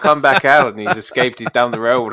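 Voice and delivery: loud Northern Irish-sounding attempt at South African; hammed-up South African accent slipping into Irish; botched South African accent. Quoting the man whose honey badger escapes, botched South African accent. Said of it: "Northern Irish accent for a South African guy".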